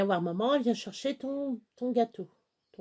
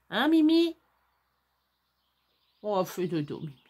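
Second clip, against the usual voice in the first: cooing to dog. Real, not acted. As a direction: coo at your little dog. Cooing at her sleeping dog.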